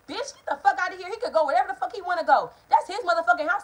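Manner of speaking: hammed-up furious mom; angry mother shouting. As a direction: mom snapping back in the argument, mock shout. Mother voiced in quarrel.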